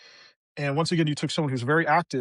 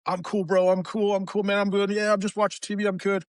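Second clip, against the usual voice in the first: higher-pitched, casual brush-off; pretending to be fine; 5 semitones higher than usual. Man brushing off worried friends, pretending to be fine. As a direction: insist all is well, covering up. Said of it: "no, I wasn't".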